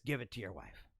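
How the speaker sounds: low, soft deadpan read; mock instruction label. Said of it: "It says".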